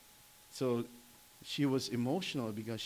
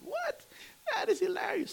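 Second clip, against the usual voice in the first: much higher, gasping what; husband stunned his wife missed the movie; excited disbelief. Retold or imitated. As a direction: act stunned that she never heard of it. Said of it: "and I said".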